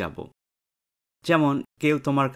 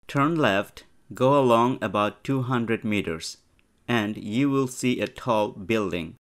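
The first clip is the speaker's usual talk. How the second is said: slow dialogue reading; much slower than usual. Shown as a demonstration. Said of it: "teacher reads dialogue slowly".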